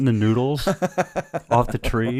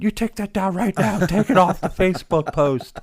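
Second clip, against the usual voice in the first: high, laughing order to take it down; husband half laughing, half ordering his wife; laughing demand; 10 semitones higher, 4 dB louder than usual. Retold or imitated. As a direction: command it through laughter. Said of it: "And I went".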